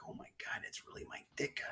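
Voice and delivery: hushed, starstruck retelling; awed whisper. Retold or imitated. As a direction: whisper it in stunned disbelief. Stunned at spotting his idol.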